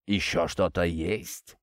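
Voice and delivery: low, slow and soft; roused from thought. A narrator's reading. Prince coming out of his thoughts to ask the captain, low and slow. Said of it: "очнувшись от дум".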